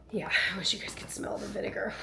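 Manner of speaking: soft unvoiced whisper. Real, not acted. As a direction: whisper it as a quick aside. Aside while cleaning with vinegar.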